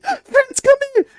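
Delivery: very high, loud summons; calling parents. Acted. Parents calling escaped kid, high frantic shout. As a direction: shriek for the child to come back. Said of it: "they're like".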